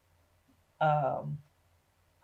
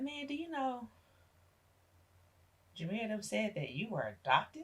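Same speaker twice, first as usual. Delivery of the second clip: teasing nephew; 6 semitones higher than usual. Retold or imitated. Nephew breaks the adoption news.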